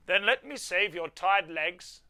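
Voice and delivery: a hint of hearty Santa; lightly voiced Santa. Santa offering Tom a sleigh ride, lightly voiced Santa. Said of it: "said Santa".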